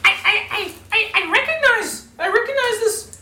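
very high excited falsetto; squeaky little gnome cleric; gnome priestess voice. Acted. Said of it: "She says".

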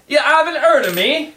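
high, shocked question. Acted. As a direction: stagger back in surprise. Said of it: "like, shocked".

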